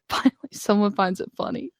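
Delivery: mock relief. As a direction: sigh it out to yourself. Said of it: "I'd be like".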